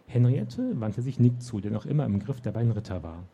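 ordinary delivery

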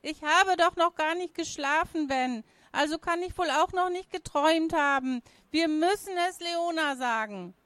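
put-on high-pitched, agitated girl; urgent, high young voice; insistent little sister. A narrator's reading. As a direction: plead to be believed in a high child's voice.

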